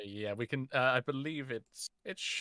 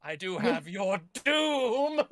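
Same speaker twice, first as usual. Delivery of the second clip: slow megalomaniac doom voice; Tuttenstein impression; 6 semitones higher, 4 dB louder, slower than usual. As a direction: slowly threaten doom like a pharaoh. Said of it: "Tuttenstein impression".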